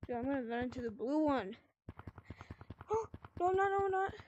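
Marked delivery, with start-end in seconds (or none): 2.9-4.1 sharp gasp then high panicked no's; gasp of fright. Real, not acted. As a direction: gasp and cry out in alarm. Running into the monster.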